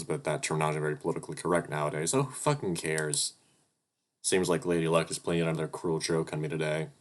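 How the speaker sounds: ordinary talk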